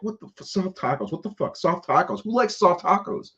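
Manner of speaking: high, squeaky mock outrage; hammed-up high-pitched rant; ranting disbelief. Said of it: "I couldn't say, like".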